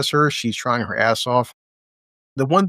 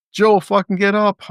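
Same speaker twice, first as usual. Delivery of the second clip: urging; 7 semitones higher, 3 dB louder than usual. Real, not acted. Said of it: "I'm gonna keep saying more F-bombs".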